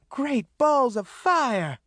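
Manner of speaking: high, drawn-out exclamation; hollering. A narrator's reading. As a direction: holler it in dismay. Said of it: "Maureen hollered".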